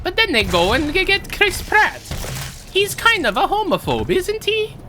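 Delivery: high, swooping plumber voice, dropping on the sigh; disapproving game mascot, pitch bouncing; Mario. Acted. Plumber grumbles about his replacement, high cartoon voice. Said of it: "I am Mario!".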